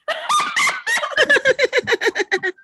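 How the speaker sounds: high, loud forced ha-ha; big hearty greeting laugh; demonstration laugh. Shown as a demonstration. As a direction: belly laugh on cue. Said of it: "let's do our greeting".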